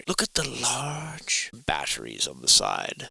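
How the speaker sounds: slow hushed whisper. Pretend. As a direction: whisper it like a secret. Whispered aside.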